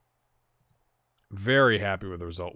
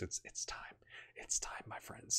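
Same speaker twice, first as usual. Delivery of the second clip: very soft, whispered confession; hushed aside; 15 dB quieter than usual. Real, not acted. Gamer telling viewers his computer is due replacing, hushed aside. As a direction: say it under the breath to the viewers.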